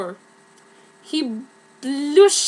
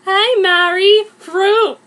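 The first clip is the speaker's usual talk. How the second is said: chirpy greeting; 6 semitones higher, 7 dB louder, slower than usual. Acted. Greeting friend in fic.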